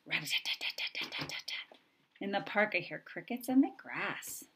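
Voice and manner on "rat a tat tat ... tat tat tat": soft, quiet tapping rat-a-tat; whispered woodpecker. A narrator's reading. Woodpecker tapping in the park, whispered. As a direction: whisper it like a little hammer. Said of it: "He goes".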